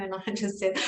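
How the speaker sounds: ordinary talk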